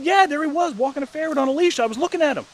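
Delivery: high, loud, insistent brother; imitating an agitated sibling; worked-up excuse. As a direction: insist on the story, pitch way up. Brother's excited excuse, retold.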